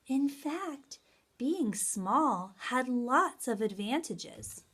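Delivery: lively, expressive picture-book reading; reading to children. Acted. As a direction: read aloud with big storytelling energy. Reads story to children.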